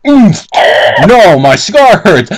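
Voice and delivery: loud, slow mock agony; hammed-up pained cry. Reading a screaming line aloud.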